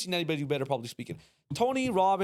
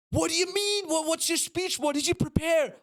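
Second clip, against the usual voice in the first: much higher, alarmed squeal; the crew's pitch shoots up in disbelief; shrill panic; 13 semitones higher than usual. Retold or imitated. Crew shrieking at the speaker who prepared nothing, very high panic. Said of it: "And they would freak out".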